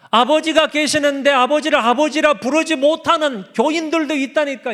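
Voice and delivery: high, loud preaching climax; loud fervent preaching voice; preaching at full force. Real, not acted. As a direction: drive it home, raising the voice.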